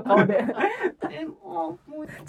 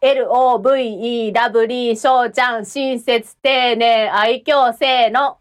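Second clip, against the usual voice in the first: lower-pitched, low-key chant; low-energy low voice; 5 semitones lower, slower than usual. Shown as a demonstration. Singer records a low-tension take of the call, low voice. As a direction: keep the call low and subdued. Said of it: "今度ちょっとテンション低い系でいきます。".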